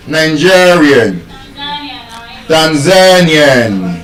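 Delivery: slow "Nigerian. Tanzanian.", GE and ZA held long; penultimate stress. Shown as a demonstration. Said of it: "stressed on the second to the last syllable".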